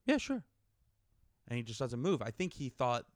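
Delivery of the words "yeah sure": much higher mock easy reply; imitating the other guy. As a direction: toss off a high breezy yeah sure. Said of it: "And he's like".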